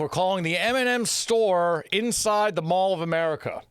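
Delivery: high, emphatic presenter intro; show intro announcement. Acted. Host sets up the phone call to the store, high announcer delivery. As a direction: announce it big, slowing toward the end.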